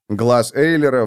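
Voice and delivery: usual speaking voice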